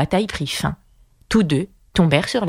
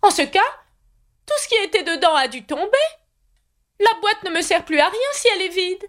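put-on high, fussy old knight voice; high knightly voice promising to escort Alice; 13 semitones higher, faster than usual. A narrator's reading. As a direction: play the kindly, dotty knight up high. Knight vexed.

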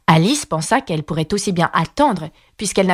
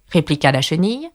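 usual speaking voice

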